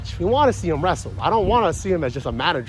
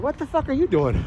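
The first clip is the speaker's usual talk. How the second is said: pitched-up exasperated yell, lightly voiced; mock outrage; 9 semitones higher than usual. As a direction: snap at her in mock disbelief. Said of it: "He's like".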